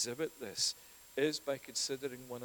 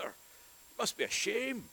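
mock taunt; 11 semitones higher, 6 dB louder, slower than usual.

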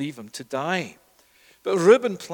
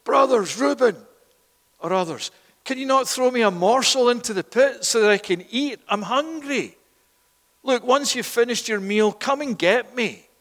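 high, loud pleading call; shouting; 7 semitones higher, 5 dB louder than usual. Acted. Joseph in the pit to brothers eating, pleading shout. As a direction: shout up to them, begging to be heard. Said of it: "And he's shouting".

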